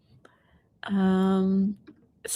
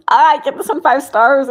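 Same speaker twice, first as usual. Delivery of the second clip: louder, higher burst of enthusiasm; excited; 6 semitones higher, 11 dB louder than usual. Real, not acted. Telling a viewer how much she loved the book, excitedly. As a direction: gush about a favourite book.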